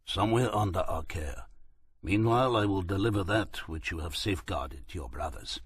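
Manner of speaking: put-on a light Turkish accent; calm driver in a Turkish accent. A narrator's reading.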